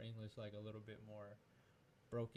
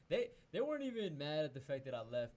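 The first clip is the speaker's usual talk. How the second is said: louder, emphatic insistence; 4 semitones higher, 8 dB louder than usual. Real, not acted. Telling a friend how his parents reacted, loud and emphatic. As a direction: push hard on weren't, louder.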